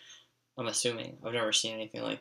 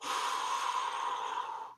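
long, heavy exhale; imitating a slow, seething breath; 6 dB quieter than usual. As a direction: breathe out hard in silent displeasure. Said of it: "he's just breathing, like".

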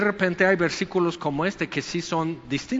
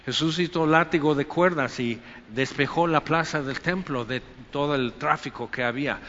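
ordinary delivery

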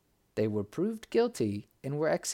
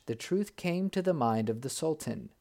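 usual speaking voice

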